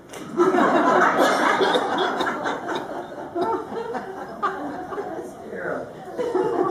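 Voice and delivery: long rising laugh; delighted laugh after the punchline; high laugh. Real, not acted. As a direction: laugh high at own joke. Big laugh at own punchline.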